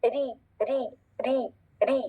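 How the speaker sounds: soft, isolated री with a light rolled R and long ee; री said thin, never heavy; thin R sound. Shown as a demonstration. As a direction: model री lightly, one at a time. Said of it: "बारीक रॉ की sound कैसी होती है".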